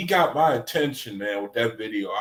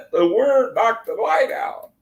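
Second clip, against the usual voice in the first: higher, giggly, rising disbelief; laughing; 10 semitones higher, 3 dB louder than usual. Real, not acted. Streamer repeating the mic story to viewers, laughing. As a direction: say it through the giggles.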